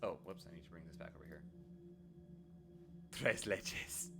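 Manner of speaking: ordinary delivery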